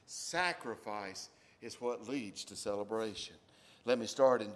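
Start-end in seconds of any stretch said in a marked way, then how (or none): 0.1-3.3 slow and deliberate; slow emphasis. Acted. Pastor stressing the main point slowly. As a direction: lay down each word of the point. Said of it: "get this".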